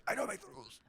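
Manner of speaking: high, soft squeaky mimicry; mock your co-host in a small high voice; Lara. Co-host mimics her partner's catchphrase, high and quiet. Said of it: "Lara goes".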